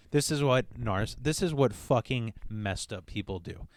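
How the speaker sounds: usual speaking voice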